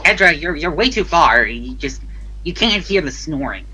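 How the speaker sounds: ordinary delivery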